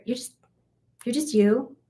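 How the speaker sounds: ordinary delivery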